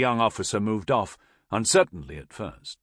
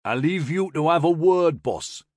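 Geordie, pitched up and louder; broad Newcastle accent; 7 semitones higher, 5 dB louder than usual. A narrator's reading. Soldier stepping aside for the officer, broad Newcastle accent. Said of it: "in a broad Newcastle accent".

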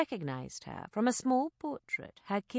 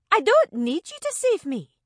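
indignant raised retort; angry; 10 semitones higher, 8 dB louder than usual. Acted. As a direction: throw it back at him furiously. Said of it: "she said angrily".

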